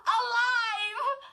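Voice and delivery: high, loud half-laughing cry; near-hysterical shout; girl shrieking that the dummy lives; frantic cry. A narrator's reading. Chris pinning the living dummy.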